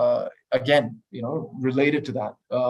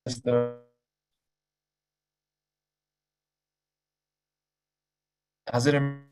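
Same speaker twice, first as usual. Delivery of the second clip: breaking up. Real, not acted. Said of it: "it's still breaking up".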